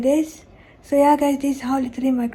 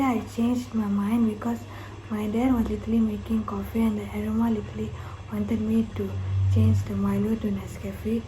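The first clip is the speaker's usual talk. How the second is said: ordinary talk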